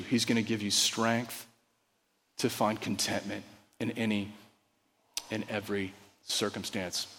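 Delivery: hushed pastoral reassurance; quiet earnest close. Real, not acted. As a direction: draw the room in gently.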